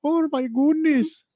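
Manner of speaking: high, loud, drawn-out Irish imitation; mock Irish accent. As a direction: tease with a slow, exaggerated Irish lilt. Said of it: "You almost did it right there".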